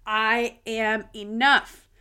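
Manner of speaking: firm, deliberate self-affirmation; slow affirmation. Shown as a demonstration. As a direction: model the affirmation for listeners to repeat. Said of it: "I want you to repeat that".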